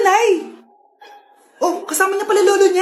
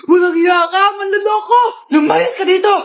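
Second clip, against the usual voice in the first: louder, angry scolding yell; furious shout; 4 dB louder than usual. Acted. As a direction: shout him down, outraged. Mother scolding cheating boyfriend. (galit na sigaw ng nanay)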